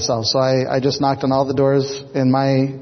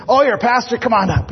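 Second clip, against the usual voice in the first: high, suddenly eager invitation; husband abruptly warms to the pastor, higher and eager; surprised welcome; 9 semitones higher than usual. Retold or imitated. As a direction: pop up in pitch, pleasantly surprised.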